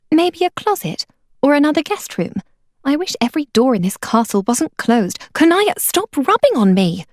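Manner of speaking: Meilin's voice. Acted. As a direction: voice Meilin, fed up with the cat. Meilin's voice, held by narrator.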